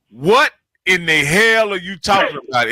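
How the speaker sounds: normal talking voice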